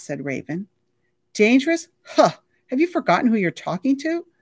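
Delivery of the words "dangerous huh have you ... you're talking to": much higher, louder incredulous scoff; mock the very idea of danger; scoffing disbelief.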